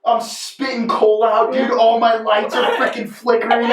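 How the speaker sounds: ordinary talk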